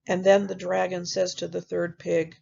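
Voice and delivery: plain reading voice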